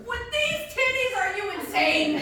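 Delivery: incredulous high retort. Acted.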